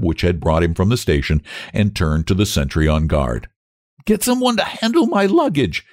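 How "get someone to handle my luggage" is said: high treble playing the commander; brisk order. A narrator's reading. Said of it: "he said briskly".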